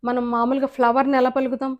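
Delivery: ordinary talk, nothing marked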